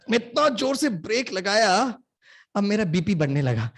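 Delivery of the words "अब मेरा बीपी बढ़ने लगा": ordinary talk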